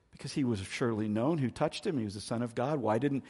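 normal talking voice

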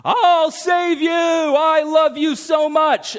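high, loud, drawn-out frantic yell; frantic screaming. Retold or imitated. A maniac screaming as he runs into the lake. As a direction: scream it like a maniac charging past. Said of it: "running and screaming".

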